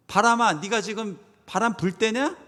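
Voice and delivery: acted outrage, rising toward a shout; angry rebuke. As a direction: tell off the wind and rain. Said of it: "막 화가 나야 돼요".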